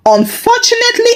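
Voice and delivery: first unfortunately pitched high and fast; emphatic. A narrator's reading. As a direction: lift the word Unfortunately up high, quickly. Repeated word, first take marked.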